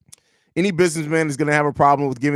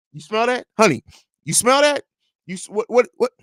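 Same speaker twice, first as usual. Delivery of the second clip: put-on high, alarmed old owner; startled rich boss; Jerry Reinsdorf voice; 5 semitones higher, 4 dB louder than usual. Jerry voiced waking to spent money.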